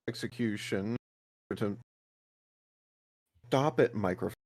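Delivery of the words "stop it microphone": ordinary talk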